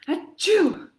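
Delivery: high, loud hammed-up achoo; cartoon sneeze; big fake sneeze. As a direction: throw a huge theatrical sneeze. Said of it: "a pretty dramatic reading".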